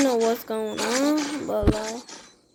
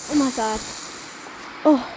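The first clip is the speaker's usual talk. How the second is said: ordinary talk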